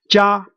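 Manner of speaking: Jia held high and level; high, unwavering Jia; first tone. Shown as a demonstration. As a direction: say Jia on one flat high pitch from beginning to end. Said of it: "these are four different tones".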